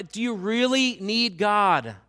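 the sermon's central question, delivered loud; slow emphatic question. Acted. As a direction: hammer the question home slowly. Climax of repeated question.

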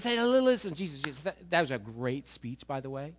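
lightly voiced fawning mom; wheedling mother. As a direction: flatter Jesus before asking a favor. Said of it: "she comes up and says".

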